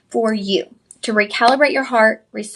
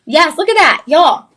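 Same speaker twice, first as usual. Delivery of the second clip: excited; 6 semitones higher, 9 dB louder than usual. Real, not acted. Host excited by discount reveal.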